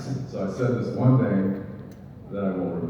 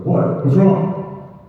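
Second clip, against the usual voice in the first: "What's wrong?" jumps much higher and louder; mock startled; 8 semitones higher, 3 dB louder, much slower than usual. Co-star answers a frantic call in alarmed surprise. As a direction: play it as suddenly alarmed, pitched up. Acting startled reply in scene.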